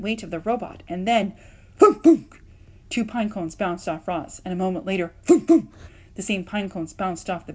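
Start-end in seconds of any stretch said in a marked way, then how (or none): 1.8-2.4 loud, high sound effect thunks. A narrator's reading. Pine cones hitting robot. 5.2-5.7 loud, high sound effect thunks. A narrator's reading. Pine cones hitting robot.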